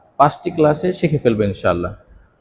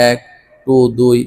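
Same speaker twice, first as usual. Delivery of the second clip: usual speaking voice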